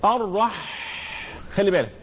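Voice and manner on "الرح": "الرح" ending in a long, breathy pharyngeal ح; bahha on the ha. Shown as a demonstration. Teacher showing students the sound in the ha, drawn-out bahha. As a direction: hold the rasping breath of ح in "الرح". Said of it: "الصوت اللي في الحاء ده".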